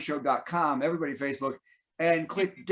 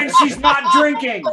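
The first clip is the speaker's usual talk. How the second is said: laughing, a bit louder; laughing tease; 5 semitones higher, 9 dB louder than usual. Real, not acted. Host teasing Patty about not drinking, laughing. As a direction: tease her through a laugh.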